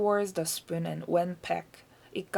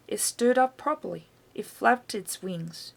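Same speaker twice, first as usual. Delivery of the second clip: plain reading voice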